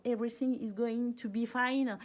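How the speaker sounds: put-on heavy French accent on the English line; playful English with a strong French accent. Reassuring viewers in English, with a heavy French accent. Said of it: "mon accent déplorable".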